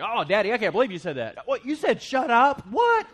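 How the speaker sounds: high, loud mock kid, aghast; shocked child. Preacher voicing his kids catching his bad words, high shocked squeal. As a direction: squeal it like a scandalized little kid.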